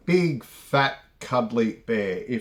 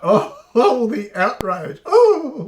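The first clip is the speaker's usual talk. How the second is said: laughing out, much higher and louder; real mocking laugh; 11 semitones higher, 8 dB louder, slower than usual. Real, not acted. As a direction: burst into laughter at the fake outrage. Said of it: "I find that incredibly funny".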